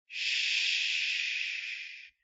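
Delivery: a soft breathy ghost hush; ghostly shush. Acted. Ghost hushing Uther in the library, soft shush. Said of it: "looks at Uther and goes".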